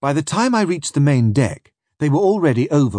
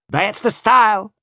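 eager overseer; 10 semitones higher than usual. Acted. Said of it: "chips in the overseer".

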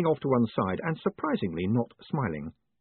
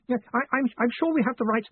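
lightly voiced, high-pitched hesitant underling; higher, hesitant reply; flustered assistant; 13 semitones higher, faster than usual. A narrator's reading. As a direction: stammer an anxious excuse to the boss. Flustered assistant answers bellowing boss.

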